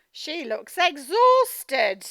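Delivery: slow, loud, drawn-out weariness; exhausted mum. Acted. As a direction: sound completely worn out, very slowly. Story reading, tired mum.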